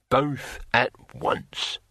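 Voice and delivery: slow, weighty challenge; blunt warrior voice; barbarian hero. A narrator's reading. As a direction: finish the challenge with deliberate weight.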